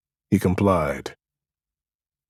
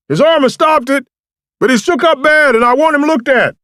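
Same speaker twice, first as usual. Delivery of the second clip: loud high shouting, much louder; called out; 11 dB louder than usual. A narrator's reading. Samson and French shouting over distance, loud call. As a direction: shout across the woods. Calling across the woods.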